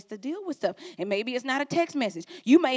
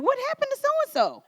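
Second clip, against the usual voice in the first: puzzled question; 3 dB louder than usual. Retold or imitated. Imitating puzzled friend.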